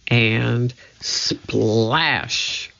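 usual speaking voice

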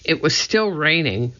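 plain reading voice

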